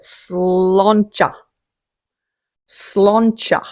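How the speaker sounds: "Sláncha" as SLAHN-cha, a broad A; Gaelic toast pronunciation. Shown as a demonstration. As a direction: say "Sláncha" slowly, stressing the first syllable. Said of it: "that's how you say it".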